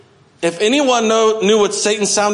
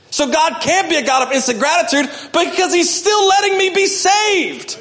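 much higher, ringing peak; climactic preaching; 11 semitones higher than usual. Real, not acted. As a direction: lift it to the climax. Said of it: "preaching climax".

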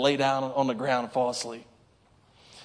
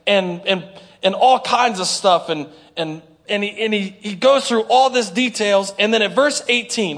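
ordinary delivery

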